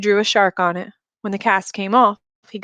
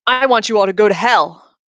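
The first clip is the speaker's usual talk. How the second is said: louder, defiant curse at everyone; a lashing out from fear; bitter outburst; 5 dB louder than usual. Acted. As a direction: spit it out at them in rage. Frightened patient lashes out.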